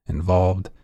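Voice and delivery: plain reading voice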